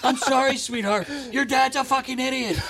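high-pitched imitation of a heated apology; exasperated aside. Player to the heckler's upset little daughter, exasperated retold apology. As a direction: keep the worked-up high voice while apologising.

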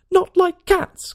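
high, loud, slow shriek; shrill passionate mouse. A narrator's reading. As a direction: squeal it out, outraged little mouse. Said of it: "in a shrill, passionate voice".

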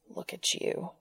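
soft, close, unvoiced; low in his ear. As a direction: murmur right into his ear. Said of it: "he said low in his ear".

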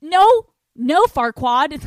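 shouted high no; hammed-up shouted no; loud mock refusal. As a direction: bark the refusal.

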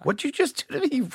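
high-pitched mock disbelief; incredulous shark. Shark to the man who swung at it, incredulous. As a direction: squeak it like an affronted shark.

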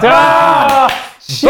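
loud, high yelp of fake alarm; mock panic shout. Real, not acted. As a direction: yell as if caught red-handed.

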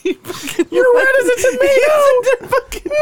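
cry-laughing high-pitched insults; laughing so hard it squeaks; hysterical laughter. Real, not acted. Hysterical laughing fit after the mochi argument.

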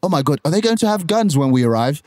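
put-on English accent; lightly acted English-accented worry. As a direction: retell it as a worried kid with an English accent. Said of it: "I have to do my English accent".

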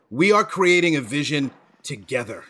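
usual speaking voice

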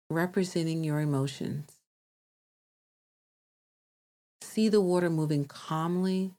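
calm meditation voice with long pauses; guided meditation. Acted. Host guides listeners to release emotions, calm meditation voice. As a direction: guide them gently, leaving space to breathe.

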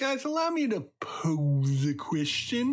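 put-on Baby Davey. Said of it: "please welcome Baby Davey".

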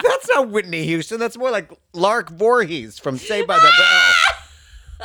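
insisting loudly that it's fake; indignant. Retold outraged rant.

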